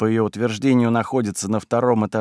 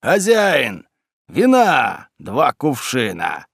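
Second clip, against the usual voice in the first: put-on drunk shouting; much slower than usual. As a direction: bellow it like a tavern drunk. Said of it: "орёт пьяным голосом".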